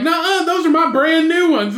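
high, loud mock-indignant retort; retold bickering. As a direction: imitate a petty squabbler talking back. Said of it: "they were just arguing back and forth".

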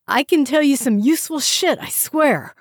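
high, loud, pleading captive; desperate pleading. A narrator's reading. Captive pleading with two officers, desperate and high.

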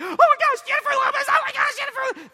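high, loud fangirl squeals, fast at the end; freaking out fans. Retold or imitated. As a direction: shriek like starstruck girls. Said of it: "They're just freaking out".

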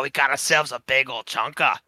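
hammed-up broad Australian accent; Crocodile Dundee. Co-host imitates a famous Australian for laughs, broad mock Australian accent. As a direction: play a mock Aussie bushman. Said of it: "everybody knows Crocodile Dundee".

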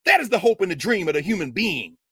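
loud, forceful proclamation; emphatic declaration. Shown as a demonstration. Contrasts human being with slave.

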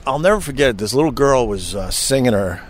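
ordinary talk, nothing marked

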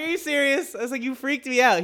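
high-pitched retold disbelief; incredulous. Driver to joking cop after being freaked out, high incredulous protest. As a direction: replay the stunned protest, pitched right up. Acted reaction to officer's joke.

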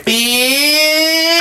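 high, long-stretched one-word reaction; drawn-out exclamation. Pretend. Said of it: "I'd say".

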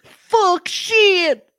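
high, teary fake sobbing plea; hammed-up weepy groom begging his parents; mock crying groom. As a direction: wail like a panicked little boy. Mocking the scared groom crying.

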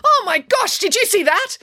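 high, loud startled yell. A narrator's reading. Mum suddenly yelling from the table at what she saw, high yell. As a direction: shriek it out in alarm. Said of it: "Mum suddenly started yelling".

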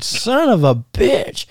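high, drawn-out mock outrage; retold exasperated curse. Wrestler cursing the referee's call, high retold exasperation.

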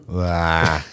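low mock groan; imitated sick moan. Wife starts going when sick, husband imitating the moan. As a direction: mimic her moaning "ah". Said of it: "then she starts going".

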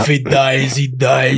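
put-on low Russian accent. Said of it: "Think like".

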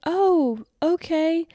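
high, surprised "oh, okay". Retold or imitated. Reacting to a friend's offer, high and surprised. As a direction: act caught off guard by the offer. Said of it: "I was just like".

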